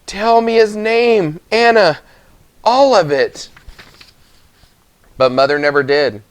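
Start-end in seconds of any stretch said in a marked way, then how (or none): 0.1-3.5 Gran pleading. A narrator's reading. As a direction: beg her for the whole name. Gran pleads with dying mother.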